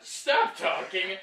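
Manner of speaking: loud, slow, laughing command; loud drawn-out telling-off; exasperated order. Real, not acted. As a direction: shut them up through a laugh. Cutting off his co-host.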